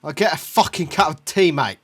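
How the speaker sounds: mock English accent. Shown as a demonstration. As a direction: show how a Brit really says it. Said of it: "get it right".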